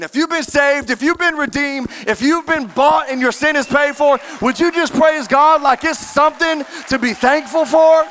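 building sermon call to praise; preaching call. Real, not acted. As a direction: lift the room into praise.

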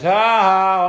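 loud, imitated jeering "ha ha"; mock scornful laugh. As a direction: act out a scoffer's big laugh.

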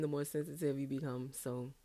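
normal talking voice

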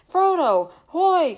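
high, loud call; lost friends calling through mist; thin distant voices. A narrator's reading. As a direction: call out thinly from far off. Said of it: "came the thin voices out of the mist".

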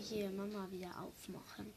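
soft unvoiced whisper. Real, not acted. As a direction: whisper it almost inaudibly. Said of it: "Ja, du musst lauter reden".

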